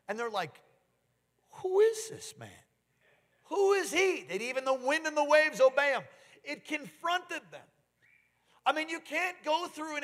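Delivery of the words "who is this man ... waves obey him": awestruck disciples. Acted. Disciples stunned by calmed storm.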